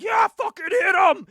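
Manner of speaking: loud, high mock crowd shout; retold crowd yelling at the wrestler to hit him. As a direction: yell it like a fan egging him on. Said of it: "the crowd's like".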